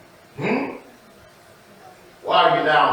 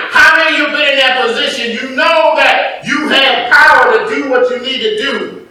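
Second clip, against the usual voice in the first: loud, raised-pitch sermon exhortation; preaching at full force; 12 semitones higher, 10 dB louder than usual. Real, not acted. Preacher warning his people against added rules, forceful preaching. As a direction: drive it home to the congregation.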